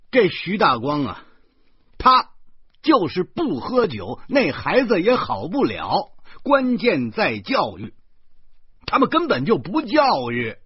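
high, loud ranting; yelling. A narrator's reading. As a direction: rant it loudly, hammering each point. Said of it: "我又嚷嚷着说". (大声嚷嚷)

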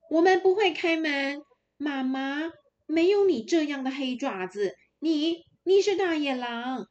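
put-on defiant kids. Little goats refusing to open for the wolf, loud and high. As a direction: kids standing firm against the wolf.

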